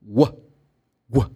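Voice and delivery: short "w" as "wuh", cut off alone; isolated first syllable. Shown as a demonstration. Teacher breaking up watched for students, "w" alone as a short syllable. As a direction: say just the "wa" start of watched. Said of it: "it's going to be like".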